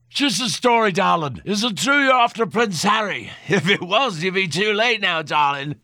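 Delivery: put-on high, leering drunken man; leering drunk reporter voice. A narrator's reading. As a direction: slur it like a boozy hack.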